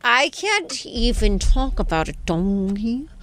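slow, put-on overwrought girly voice; hammed-up doggy-lover distress; crazy girl voice. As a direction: drag it out as if too upset to talk. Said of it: "using my crazy girl voice".

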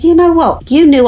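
confronting her ex, high and forceful; impassioned retort. Retold or imitated. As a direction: call him out, high and loud. Confronting ex-husband, retold.

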